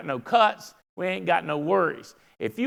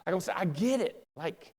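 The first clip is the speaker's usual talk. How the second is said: mock empathy; 6 dB quieter than usual. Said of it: "I'm like".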